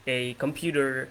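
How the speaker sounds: ordinary talk, nothing marked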